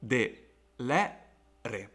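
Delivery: "de, le, re" split with clear pauses; "de, le, re" in separate beats; syllable by syllable. Shown as a demonstration. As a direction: sound out "de, le, re" one syllable at a time. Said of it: "It has three syllables".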